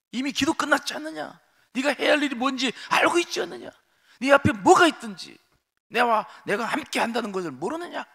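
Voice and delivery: high, loud stern rebuke; strong rebuke. Acted. God strongly rebuking Moses, high and loud. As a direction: press him hard with each question. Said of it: "강하게 질타하셔요".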